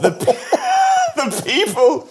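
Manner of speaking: helpless laughter breaking up the words; laughing. Real, not acted. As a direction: tell it through high-pitched giggles. Interviewee laughing while telling charades story.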